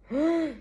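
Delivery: short high-pitched startled ah; sudden high shriek; high cry. Acted. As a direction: yelp as the flames appear. Cries out amid fire vision.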